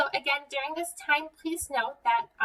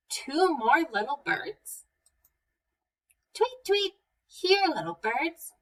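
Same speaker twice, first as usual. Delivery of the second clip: higher, animated picture-book voice with drawn-out phrases; children's story reading; 5 semitones higher, 4 dB louder, slower than usual. Acted. Teacher reading a puppy story to small children, animated storytime voice. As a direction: read it playfully, punchy woofs and high-pitched tweets.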